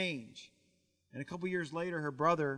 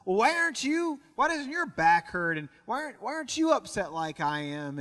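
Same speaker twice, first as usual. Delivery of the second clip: higher, louder, incredulous complaint; acted-out upset, pitch jumping up on the questions; exasperated monk; 4 semitones higher, 4 dB louder than usual. Upset second monk questioning his untroubled companion, exasperated.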